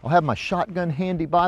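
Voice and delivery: ordinary talk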